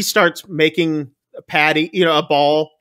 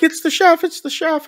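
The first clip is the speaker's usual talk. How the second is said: mock urgent outcry; 13 semitones higher than usual. Guests urgently point out chef.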